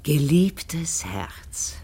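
slow, breathy whisper of an endearment; tender whisper. Acted. Widow treasuring her dead husband's words: tender whisper. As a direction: savour "Geliebtes Herz" in a slow, unvoiced whisper. Savours the letter's opening words.

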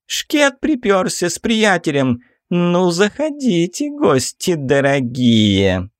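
high, drawn-out mincing voice; a high camp mocking welcome; sassy drag queen. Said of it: "повторил чуть громче".

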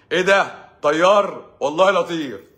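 loud, high jealous man blurting out; mock jealous outburst. Husband fired up over a pilot, mock loud outburst. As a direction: puff up and yell like a wounded ego.

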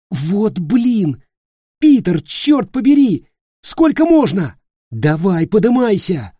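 high, loud, exasperated shouting; exasperated yelling. Acted. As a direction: yell at him to get up. Nick exasperated, yanking Peter.

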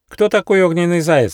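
put-on high eager kid voice; young fox voice. A narrator's reading. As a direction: ask like a curious youngster. Fox character voice.

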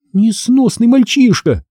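high-pitched indignant exclamation; mildly acted indignation. A narrator's reading. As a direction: scold in outrage, pitch jumping up. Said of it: "возмутилась я".

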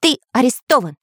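threatening. A narrator's reading. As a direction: declare it firmly, with menace. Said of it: "угрожающим голосом произнёс Самоделкин". (угрожающе и твёрдо)

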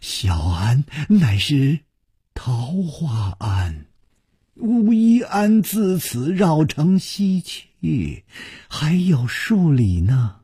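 put-on slow, drawn-out elderly nun's voice; unhurried old woman giving directions. A narrator's reading. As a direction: explain slowly, stretching the words like an aged nun. Old nun answers the stranger.

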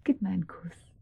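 soft, breathy plea; intimate whisper. Acted. Woman whispering to her lover for a kiss. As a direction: whisper it close, asking for a kiss.

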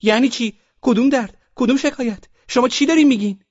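high, loud outraged woman's cry; a woman leaping up in protest; indignant woman. Acted. A woman jumps up and shouts at the officials, high and outraged. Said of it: "زنی از جایش پرید".

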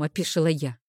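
ordinary delivery, nothing marked

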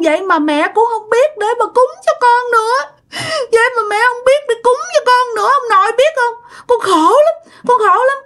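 put-on very high, tearful child's lament; crying child. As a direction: wail it out like a miserable little child. Spirit child laments to grandpa.

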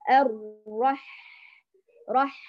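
الرح with the breath flowing freely; correct soft ح. Shown as a demonstration. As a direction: let ح in الرح flow softly. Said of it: "لاحظتي الصوت جرى والنفس جرى".

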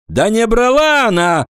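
high-pitched offhand denial; careless voice. A narrator's reading. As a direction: toss out a sudden high objection. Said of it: "послышался вдруг небрежный голос Агафонова".

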